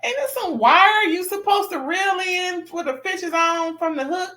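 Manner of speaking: high, amused disbelief; incredulous question. Retold or imitated. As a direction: ask way up high like it's obvious. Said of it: "I'm like".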